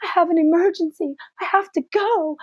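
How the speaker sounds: imitating a crying excuse; mock tearful. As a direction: fake an urgent emergency. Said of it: "I'm crying and I'm like".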